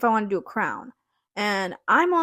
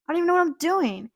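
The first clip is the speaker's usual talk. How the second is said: higher, rushed flustered panic; 7 semitones higher, faster than usual. Retold or imitated. Thrown into a procedure at the interview, rushed flustered panic. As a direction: blurt it out fast, in over your head. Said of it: "Oh, shoot".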